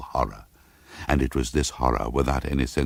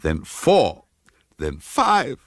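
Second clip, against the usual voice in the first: rising nervous count; 8 semitones higher, 4 dB louder, much slower than usual. A narrator's reading. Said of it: "she counted".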